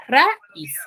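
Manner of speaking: "raíz" broken in two, ra, then íz; "raíz" pulled apart at the hiatus between a and í; split into syllables. Shown as a demonstration. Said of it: "Y forman dos sílabas".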